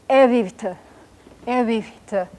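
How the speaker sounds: evivte with a whispered syllable after the second V sound; whispered middle vowel. Shown as a demonstration. Teacher pronounces blue for beginners, evivte with whispered middle. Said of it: "whispered vowels in the middle".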